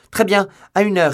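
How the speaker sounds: high, very loud call back; ringing, high-pitched distant call; shouted reply. A narrator's reading. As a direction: shout agreement back down the street. Shouts back from street corner.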